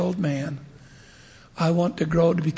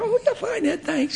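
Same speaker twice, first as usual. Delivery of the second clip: much higher, offhand mock dad; casual dad; 13 semitones higher, 4 dB quieter than usual. A hint of the unconcerned dad thanking the bishop. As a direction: toss it off like a careless father. Unbothered dad voiced in story.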